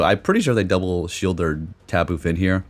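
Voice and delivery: ordinary talk, nothing marked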